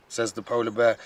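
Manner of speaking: ordinary delivery, nothing marked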